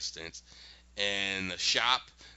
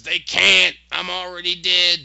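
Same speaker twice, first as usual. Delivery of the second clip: louder, higher imitated scream; 6 semitones higher, 12 dB louder than usual. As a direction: shout it back in defiance. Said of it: "He screams".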